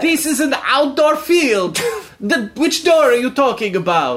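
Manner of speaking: put-on high-pitched, baffled Italian accent; Italian soccer player. Italian player baffled by talk of a door, incredulous. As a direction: protest in bafflement as an Italian footballer.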